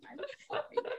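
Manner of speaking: squeaky breaking I'm fine; shaky sigh. Real, not acted. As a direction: voice cracks high and soft. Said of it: "I'm trying not to cry".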